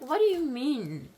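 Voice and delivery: loud, high, drawn-out demand; slow, loud cry of disbelief; incredulous outburst. Retold or imitated. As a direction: act out an outraged "excuse me". Retold confrontation over the son.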